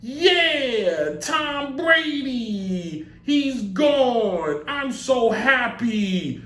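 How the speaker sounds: high, drawn-out mock cheer; mock celebration. Rival teams hearing Tom Brady retired, drawn-out mock glee. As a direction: stretch each phrase out high, hammed-up relief. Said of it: "was just like".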